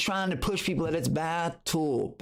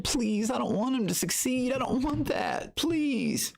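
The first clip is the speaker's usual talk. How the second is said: high, whiny fake begging; a hammed-up high whimper of a desperate rival; mock pleading; 11 semitones higher than usual. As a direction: beg like a pathetic hater, hammed-up. Mocking the hater's desperation.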